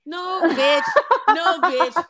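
hammed-up loud no with a laugh; loud mock retort. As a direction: shout it back, amused and exasperated. Mock retort to her younger self.

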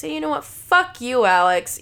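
loud, hammed-up outburst; mock screaming. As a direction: swear at him loudly, punching the words. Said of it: "Me screaming at Alex".

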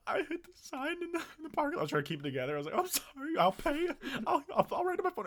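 very high, teary voice; tearful whimper. Retold or imitated. Crying student confessing to office, very high teary voice. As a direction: squeak it out, holding back tears.

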